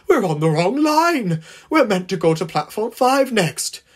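high, loud coach protest; calling out. A narrator's reading. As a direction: complain indignantly, pitched right up. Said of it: "called a very groggy coach".

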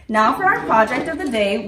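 ordinary delivery

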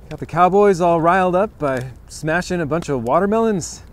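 normal talking voice

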